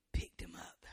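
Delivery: breathy whispered aside; preacher whispering the scripture to the church. Acted. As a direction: drop to a hushed whisper. Quoting scripture tenderly.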